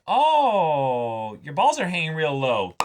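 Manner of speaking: a jeering stranger's mock taunt; mock teasing heckler. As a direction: play the smug heckler pointing it out. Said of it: "someone coming up and basically saying".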